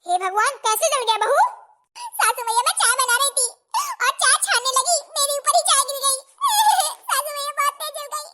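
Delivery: high, loud, half-crying complaint; whimpering bride. Acted. Burnt bride tells mother-in-law, whimpering loudly. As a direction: whine about the burn.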